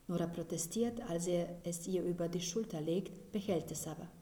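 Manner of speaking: usual speaking voice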